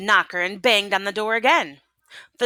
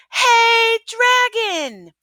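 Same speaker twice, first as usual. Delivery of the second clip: much higher, much louder yell; shout as loud as possible; 6 dB louder, much slower than usual. A narrator's reading. Princess yelling into the sleeping dragon's ear, full loud shout. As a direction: bellow into his ear to wake him. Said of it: "She shouted as loud as she could".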